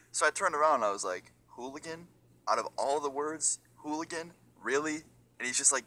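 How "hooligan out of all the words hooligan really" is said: higher-pitched, questioning disbelief; retold incredulity. Teenager turning back on the guard who insulted him, retold incredulity. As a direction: echo the insult in mock disbelief. Retold indignation at being called hooligan.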